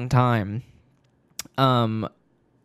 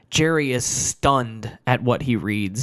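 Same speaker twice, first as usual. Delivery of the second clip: usual speaking voice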